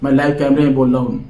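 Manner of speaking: normal talking voice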